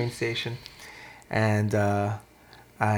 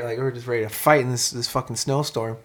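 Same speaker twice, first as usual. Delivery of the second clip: normal talking voice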